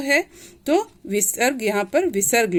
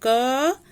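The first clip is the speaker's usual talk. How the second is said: क held long on its vowel; drawn-out क; 3 semitones higher than usual. Shown as a demonstration. Teacher showing the अ inside क, drawn out. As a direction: stretch क so the अ rings on. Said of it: "इसको खींच के पढ़ेंगे".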